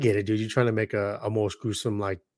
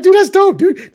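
high, loud hyped-up outburst; excited; 11 dB louder than usual. Retold or imitated. Thrilled fan reacting to a friend's retelling, high and loud.

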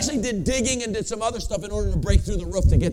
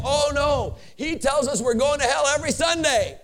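cheerful; 6 semitones higher, 4 dB louder than usual. Retold or imitated. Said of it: "they're all so happy".

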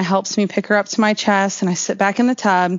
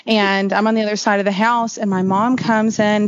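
ordinary delivery, nothing marked

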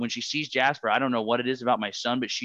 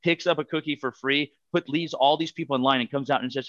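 ordinary talk, nothing marked

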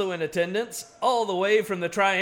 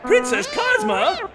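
startled, high and loud question; surprised; 8 dB louder, slower than usual. Real, not acted. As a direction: caught off guard by her sudden arrival. Announcer startled by her arrival.

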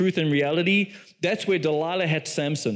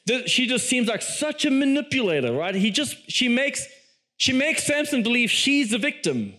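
ordinary talk

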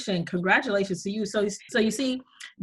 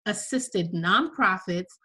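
ordinary talk

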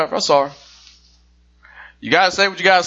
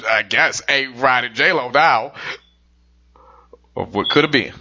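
normal talking voice

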